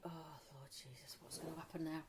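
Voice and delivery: hushed panicked whisper to herself. Retold or imitated. Guest dreading a chat with the host, whispered.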